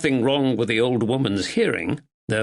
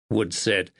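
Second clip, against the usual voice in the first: usual speaking voice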